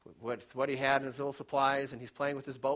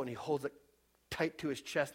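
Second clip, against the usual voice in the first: usual speaking voice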